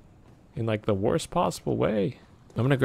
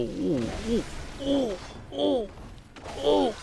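high pained ooh cries, rising; real yelping ooh; 11 semitones higher, 5 dB quieter than usual. Real, not acted. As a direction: yelp through the hits. Hurt by monster.

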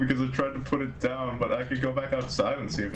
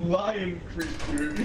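laughing; 7 semitones higher, much slower than usual. Real, not acted. Laughing while recounting death.

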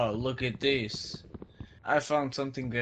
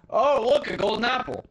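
higher, louder thrilled exclamation; excited find; 11 semitones higher, 8 dB louder than usual. Real, not acted. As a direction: sing out the discovery, delighted. Finds a golden apple.

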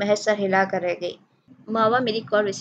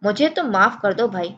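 usual speaking voice

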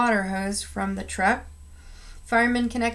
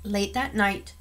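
plain reading voice

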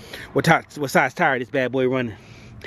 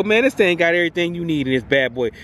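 ordinary talk

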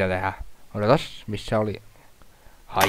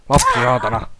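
louder curse; 2 semitones higher, 5 dB louder than usual. Real, not acted. Gamer yelling at the child enemy, louder curse. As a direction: bark "Lapsi saatana" out loud at the screen.

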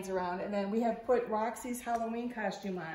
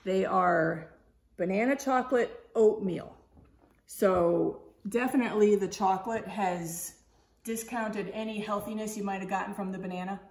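ordinary delivery

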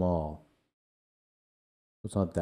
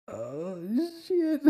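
oh shit, pitched way up; shocked yelp. Real, not acted. Reacting to the gross video.

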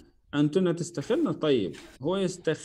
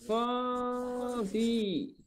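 خافي very slow and high, aa held long; long drawn-out vowels; 7 semitones higher, 5 dB quieter, much slower than usual. Shown as a demonstration. Teacher models the feminine command, drawn-out خافي. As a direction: stretch out خافي, softer and higher.

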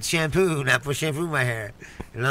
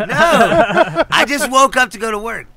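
higher, louder, insistent denial; exasperated protest; 8 semitones higher, 6 dB louder, slower than usual. Real, not acted. Storyteller protests his friend's crude interruption, exasperated. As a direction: shut down the teasing with raised voice.

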